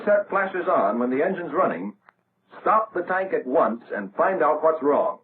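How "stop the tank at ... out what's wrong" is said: usual speaking voice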